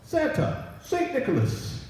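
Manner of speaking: high calling voice. Acted. Unseen God calling out to Santa, high and ringing. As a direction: call the name out from afar. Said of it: "I heard a voice calling".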